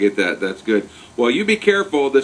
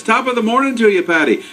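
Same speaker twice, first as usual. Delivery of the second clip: imitating Irish brogue, higher; mock Irish greeting; Irish accent; 9 semitones higher than usual. Host mimics the Irish greeting.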